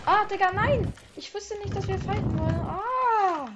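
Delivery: high-pitched startled outcry; shocked. Real, not acted. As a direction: caught off guard, crying out. Shocked at being attacked.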